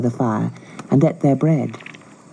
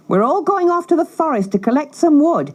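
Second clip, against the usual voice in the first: harsh stepmother; 11 semitones higher than usual. Acted. Stepmother ordering children.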